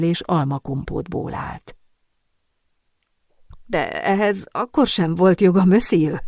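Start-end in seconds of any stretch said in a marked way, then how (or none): none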